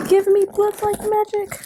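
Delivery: high, slow excited squeal of the title; squealed book title. Real, not acted. Unboxing her book mail, squeals the title in delight. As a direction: gush over the book's name. Reading the new book's title.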